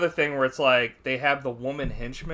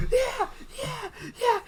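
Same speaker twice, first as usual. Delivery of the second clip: very high falsetto squeals building louder; mock burning-man yelps; 10 dB louder, slower than usual. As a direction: imitate pop-star squeals while on fire. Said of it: "like, a [name] jump".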